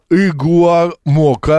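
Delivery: slow, drawn-out word in a put-on voice. Real, not acted. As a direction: intone it slowly on a dropped breath. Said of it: "почему таким голосом ты делаешь?".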